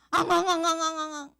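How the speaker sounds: rapid-fire high mock wails; loud, comically repeated pretend bawling; imitated crying. Teacher shows the crying inside Sua's heart, high repeated mock wails. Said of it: "우리 수아는 마음에".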